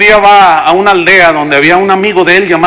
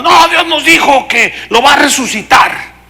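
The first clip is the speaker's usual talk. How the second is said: high-pitched zealous proclamation; mock fanatic fervor; 7 semitones higher than usual. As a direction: play the fanatical believer, voice raised high. Mimics fanatical brothers' claim. (fervor fanático imitado, voz aguda)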